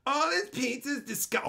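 high, unpleasant put-on customer voice; mocked complaining woman. Woman complaining at Pizza Pizza, mockingly imitated voice. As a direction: imitate her awful voice as she complains. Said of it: "Is that how your voice sounds".

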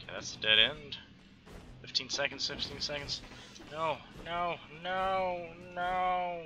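usual speaking voice

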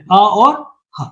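"अ और ह" slowly, each sound on its own; sounds separated. Shown as a demonstration. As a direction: split "अ और ह" into vowel, pause, then h. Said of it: "इसमें भी दो ध्वनियां हैं".

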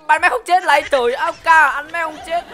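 loud, high, exasperated yell at the game; can't believe the enemy survived; frustrated shout. Real, not acted. Streamer yelling at the screen in loud disbelief.

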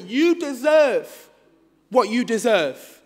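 drawn-out, weighty verdict; slow pronouncement. Acted. Jackal passes judgement on the lion, slow pronouncement. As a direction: stretch each word like passing sentence.

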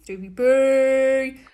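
long drawn-out call at normal pitch. Real, not acted. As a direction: stretch the name as you call for him. Calling out again to Stevie.

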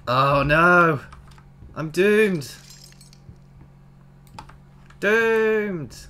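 loud, hammed-up wail of doom; mock despair. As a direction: cry out in playful melodramatic despair.

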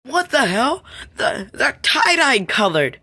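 loud, high-pitched disbelief; shocked. Acted. Seeing the tie-dye colours, shocked and loud. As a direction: exclaim in shock, drawing out the words.

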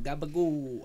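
mock New York accent. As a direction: say it like a Sopranos New Yorker. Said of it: "I can do the New York accent too".

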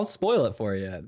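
low, lightly menacing tease; mock ominous. Teasing his friend about spoilers, mock ominous low voice.

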